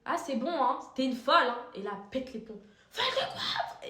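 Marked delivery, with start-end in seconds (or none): 0.0-1.6 much louder, higher jab; loud put-down. Retold or imitated. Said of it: "regardez, je fais". 2.9-3.9 very high, laughing mock outburst; aggressor snapping. Voicing the aggressor losing it.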